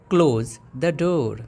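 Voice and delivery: "Close the door" stretched out, word by word; slow teaching repeat. Shown as a demonstration. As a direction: model it slowly for the class. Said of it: "अब बोलना है दरवाज़ा बंद करो". (धीरे और साफ़ दोहराना)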